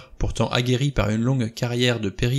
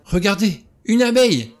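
high, loud startled cry; alarmed cry; 10 semitones higher, 7 dB louder than usual. A narrator's reading. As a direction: cry out to make everyone look. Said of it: "s'écria Vera".

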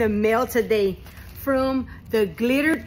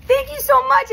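thanks pitched high, close to tears; touched, near tears; 11 semitones higher, 4 dB louder than usual. Real, not acted. Grateful vlogger thanking the sender of her gift, near tears. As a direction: let the thanks break upward, nearly crying. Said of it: "You almost made me cry".

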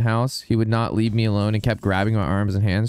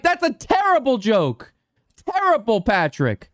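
retold scolding; 10 semitones higher, slower than usual. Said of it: "I'm like".

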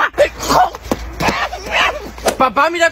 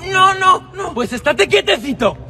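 ordinary delivery

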